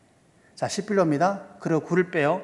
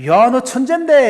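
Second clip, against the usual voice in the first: loud, high, drawn-out exclamation; amazed praise; 9 semitones higher, 12 dB louder than usual. Retold or imitated. Father to son over his altered sums, loudly amazed. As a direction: act out a dad bursting with mock awe. Acted praise of son's clever trick.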